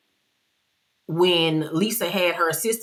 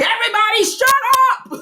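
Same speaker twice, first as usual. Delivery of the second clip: high shrieking shout; yelling; 6 dB louder, faster than usual. Retold or imitated. Meredith cutting off the fight, high shrieking shout. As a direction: scream over the arguing. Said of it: "So Meredith was like".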